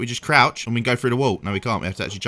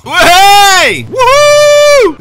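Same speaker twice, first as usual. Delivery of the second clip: very high, loud cheering whoop; excited whoop; 12 dB louder, much slower than usual. Real, not acted. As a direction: whoop with delight. Celebrating in the game car.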